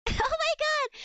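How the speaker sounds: high, loud squeal of disbelief; real flustered shriek; flustered outburst. Real, not acted. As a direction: burst out in shocked delight. Reacting to his flirty message.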